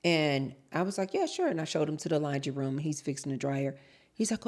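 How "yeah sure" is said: high chirpy yes. Retold or imitated. Retold reply to contractor.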